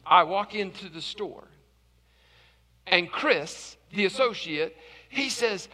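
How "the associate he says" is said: ordinary delivery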